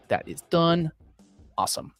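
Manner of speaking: usual speaking voice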